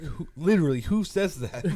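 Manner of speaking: incredulous. Retold or imitated. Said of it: "and you're like".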